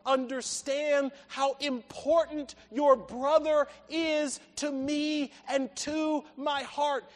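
a father pressing each word on his son; impassioned appeal. Acted. Father's appeal builds in intensity.

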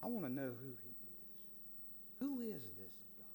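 soft, slow, wondering preacher; hushed awe. Real, not acted. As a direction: drop to a hush and draw the words out. Awed question after testimony.

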